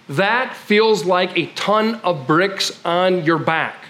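ordinary talk, nothing marked

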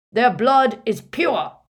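loud, slow, imposing declaration; deep booming voice. A narrator's reading. Harry as Runcorn defending the Muggleborns, booming. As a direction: boom it impressively. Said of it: "his deep voice echoed impressively".

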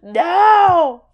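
high, loud drawn-out mock wail; melodramatic cry. Answering the Star Wars father reveal, melodramatic cry. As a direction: howl it in overblown movie anguish. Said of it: "in Star Wars".

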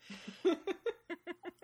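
a high real giggle; giggling at the crocodile line. Real, not acted. Host giggles at her crocodile joke, high giggle.

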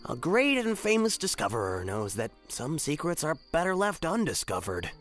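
sad. Acted. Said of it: "Opus said sadly".

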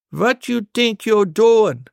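put-on high, slow accented old grandpa voice; accented scolding grandpa. Grandpa catching the boy in the act, accented scolding. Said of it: "Grandpa asked me".

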